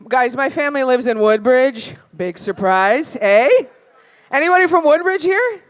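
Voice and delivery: ordinary delivery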